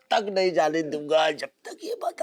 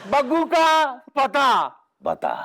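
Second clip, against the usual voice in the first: raised, higher interrogating voice; forceful demand; 4 semitones higher, 4 dB louder than usual. Acted. Kidnapper pressing captive for Baggu's whereabouts, forceful demand. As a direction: demand it hard, word by word.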